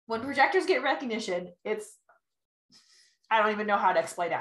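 normal talking voice

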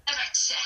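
JEG VET IKKE as one loud, slurred "e-vetshe"; Bergen dialect pronunciation. Shown as a demonstration. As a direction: imitate the locals, "Jeg vet ikke" squashed together loudly. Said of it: "die sagen dann".

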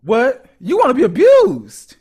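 loud, high-pitched shocked question; incredulous. Real, not acted. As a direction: blurt it out in real disbelief. Shocked reaction to rough-play confession.

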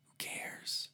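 whispered aside; muttered aside, barely audible. Real, not acted. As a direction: breathe it out under your breath. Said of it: "whispered aside".

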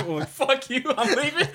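high, laughing huff; laughing mock exit. Imagining the mailman's comeback, laughing high. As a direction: storm off while cracking up. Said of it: "It's like".

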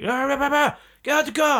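loud, high garbled yelling turning into an order; high, loud mock bellow; imitated police shouting. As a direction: mimic an officer shouting over himself. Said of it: "they're shouting at you".

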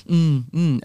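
fake-interested humming; mock attentive murmurs; feigned interest. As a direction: nod along with polite, empty hums. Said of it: "fake interest when you're having a conversation".

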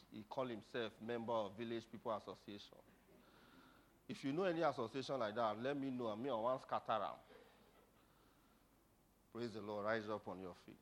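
ordinary talk

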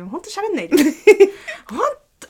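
high squeaky laugh breaking through; laughing while begging for silence; stifled giggles. Real, not acted.

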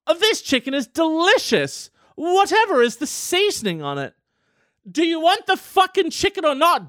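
high, frustrated pushy host; exasperated host. Pretend. Host offering chicken gets fed up with the dodge, high and irritated. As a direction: push the chicken, losing patience.

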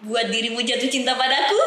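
normal talking voice

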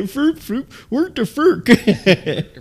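high, drawn-out mock chef babble; laughing, slowed chef gibberish; Swedish Chef impression. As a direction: stretch the nonsense out like the Muppet chef, very slow. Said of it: "Like the Swedish Chef?".

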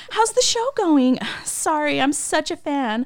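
high, drawn-out gushing; over-enthusiastic fan. Retold or imitated. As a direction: mimic a starstruck fan laying it on thick. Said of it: "tone it down a little bit".

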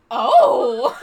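loud startled gasp with a laugh; shocked oh. Retold or imitated. Reacting to the book's back-cover line, loud startled oh. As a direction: jolt of surprise.

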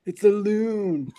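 slow, high, loud drawn-out quote; mock-dramatic line delivery, stretched out; hammed-up movie line. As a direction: draw out "loon" in a high, comic impression. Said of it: "when he said".

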